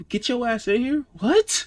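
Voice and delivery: high-pitched, rising disbelief; baffled quote, jumping up to a high "what"; incredulous echo. Retold or imitated. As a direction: repeat it like it makes no sense. Repeats phrase in disbelief.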